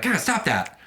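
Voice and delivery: high, fed-up snap at the dog; exasperated scolding. Retold or imitated. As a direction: tell the dog off, half amused. Owner scolding dog at wall.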